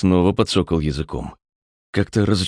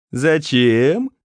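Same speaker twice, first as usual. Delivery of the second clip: mock Caucasian accent, pitched higher and drawn out on a rising tune; mimicking Dauda's Caucasian accent; 8 semitones higher, 3 dB louder than usual. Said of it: "передразнивая акцент Дауда".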